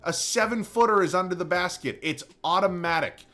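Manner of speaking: real frustrated outburst, dragged out; angry rant. Real, not acted. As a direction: vent at the game designers. Ranting about the game.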